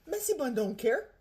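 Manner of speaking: ordinary talk, nothing marked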